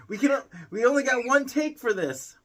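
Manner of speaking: half-laughing exasperation at the interrupting pet; exasperated at pet. Real, not acted.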